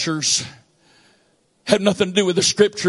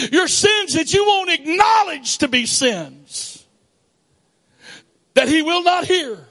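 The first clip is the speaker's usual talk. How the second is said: higher, fervent reading of scripture; preached scripture reading; 7 semitones higher than usual. Acted. Preacher reading Isaiah to the congregation, fervent. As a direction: read Isaiah like a sermon.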